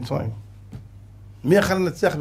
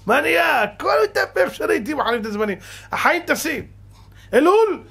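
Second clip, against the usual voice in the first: much higher, near shouting; exclaiming; 10 semitones higher than usual. Acted. Preacher to congregation about the fleeting holidays, exclaiming. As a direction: marvel at how time flies.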